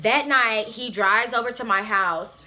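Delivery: ordinary talk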